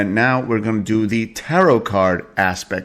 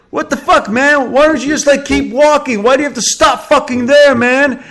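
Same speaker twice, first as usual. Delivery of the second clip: high, loud mock yell at the narrator; retold angry yelling; 7 dB louder than usual. Skateboarder yelling at him for stopping on the path, loud and high. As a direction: bark it like an irate skateboarder. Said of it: "the guy starts fucking yelling at me".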